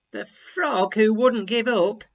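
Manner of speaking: ordinary delivery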